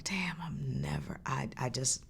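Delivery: breathy whisper to herself; whispered thought. Retold or imitated. A whispered inner voice after her concerts. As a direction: hush it like a private despair.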